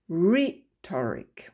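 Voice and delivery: slow rhetoric, stress on RHE. Shown as a demonstration. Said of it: "Les exceptions". (accent tonique sur RHE dans rhetoric)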